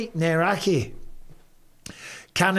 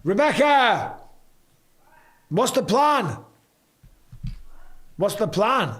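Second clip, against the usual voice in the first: raised, higher-pitched call; 7 semitones higher, slower than usual. Real, not acted. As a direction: call out to someone in another room. Calls to someone off mic.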